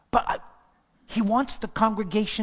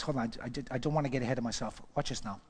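soft, lower, rushed aside; muttered aside; 8 semitones lower, 10 dB quieter, faster than usual. Real, not acted. Preacher mutters to himself while looking at his notes. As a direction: mumble to yourself while finding your place.

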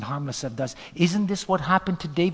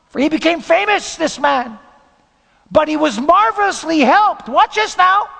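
preaching at full force; 7 semitones higher, 8 dB louder than usual. Acted. Preaching builds to a climax.

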